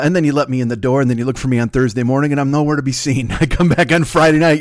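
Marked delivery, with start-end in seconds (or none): none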